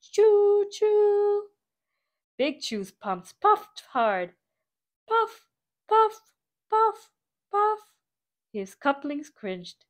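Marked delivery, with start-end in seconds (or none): 0.0-1.5 high loud choo choo whistle; train whistle cheer. Acted. Papa train cheering his son with a loud whistle. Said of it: "Papa Pufferbelly cheered". 5.1-7.8 high effortful puffs; puffing engine. Acted. Little train puffing hard up the mountain.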